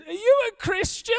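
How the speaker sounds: high, wailing mock-crying voice; hammed-up weepy rising question; tearful question. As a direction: imitate a sobbing woman asking through tears. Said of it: "she's crying".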